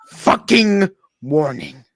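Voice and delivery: slow, loud, menacing warning; loud threat. Acted. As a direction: spit out the last warning.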